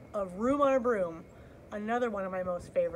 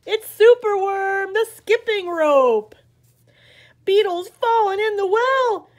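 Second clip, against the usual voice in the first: put-on lively read-aloud for little kids; animated picture-book reading; story narration; 5 semitones higher, 13 dB louder than usual. A narrator's reading. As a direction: read it like story time on the rug. Reads picture book to children.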